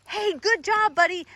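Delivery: bright, high praise for the dog; happy praise for a puppy; upbeat praise. Shown as a demonstration. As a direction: cheer him on, high. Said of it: "you're gonna give him feedback".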